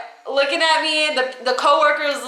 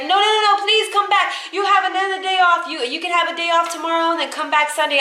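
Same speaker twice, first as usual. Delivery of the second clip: high, fast bargaining; pleading; 5 semitones higher, faster than usual. Retold or imitated. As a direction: imitate a boss begging and making hurried offers. Boss begging her to return.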